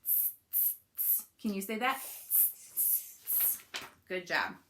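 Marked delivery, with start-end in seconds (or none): 0.0-1.3 three sharp voiceless "tss" sounds; short, evenly spaced monkey hisses; monkey noise. Acted. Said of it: "channel your inner monkey". 2.0-3.6 three sharp voiceless "tss" sounds; short, evenly spaced monkey hisses; monkey noise. Acted. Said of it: "channel your inner monkey".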